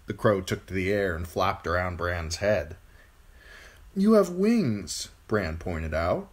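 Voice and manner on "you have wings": put-on high, slow boy voice; protesting boy. A narrator's reading. Bran answering the flapping crow, high boy voice. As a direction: point it out plaintively.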